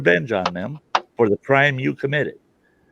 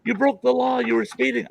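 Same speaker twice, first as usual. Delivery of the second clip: higher, stern mock cop; hammed-up cop; scolding cop; 13 semitones higher than usual. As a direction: play the cop telling him off. Cop scolding in retold story.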